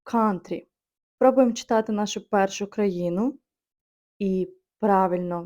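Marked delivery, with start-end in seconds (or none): 0.0-0.7 Country with the first syllable stressed; COUN-try, front-stressed. Shown as a demonstration. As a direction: hit the start of Country, let the second syllable fall. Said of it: "наголос падає на перший склад".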